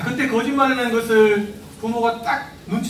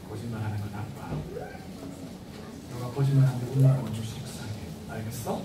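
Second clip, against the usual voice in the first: low, much softer stern talk; quiet and firm; 8 semitones lower, 8 dB quieter than usual. Shown as a demonstration. Parent quietly correcting a lying child, softer and firm. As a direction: hush it down but mean it. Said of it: "작은 소리로 하시면 돼요".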